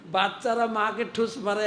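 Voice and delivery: ordinary talk, nothing marked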